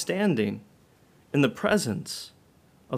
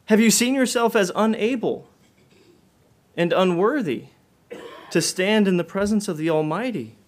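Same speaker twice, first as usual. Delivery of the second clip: higher, louder pleading questions; urgent preaching appeal; 5 semitones higher, 4 dB louder than usual. Acted. Preacher to congregation, urgent pleading questions. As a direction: press each question home to the hearers.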